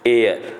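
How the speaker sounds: एयर with a silent h, starting on the vowel. Shown as a demonstration. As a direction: say एयर without the h. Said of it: "इसको हम क्या पढ़ेंगे?". (एयर को बिना ह के बोलें)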